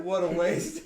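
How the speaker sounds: loud, high, laughing lament; amused outcry through laughter; laughing dismay. Real, not acted. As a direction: cry it out while laughing. Laughing at squandered wish.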